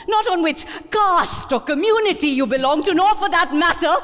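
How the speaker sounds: building from firm to a furious shout; rising anger. A narrator's reading. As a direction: let the resentment boil over. Elk's rant builds to outburst.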